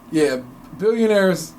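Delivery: ordinary talk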